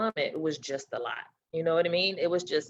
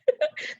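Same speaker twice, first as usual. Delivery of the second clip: high laugh. Real, not acted. Laughing while retelling.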